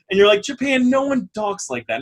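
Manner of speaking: ordinary delivery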